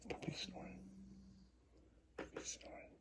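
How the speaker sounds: hushed whisper so the sleeping puppy stays asleep. Real, not acted. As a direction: barely breathe the words. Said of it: "you probably hear my puppy snoring".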